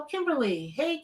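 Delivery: normal talking voice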